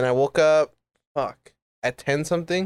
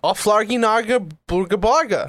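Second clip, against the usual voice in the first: Sims gibberish; 6 semitones higher than usual. Pretend. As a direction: blurt fake Sim words. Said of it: "the sim language".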